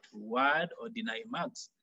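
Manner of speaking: ordinary talk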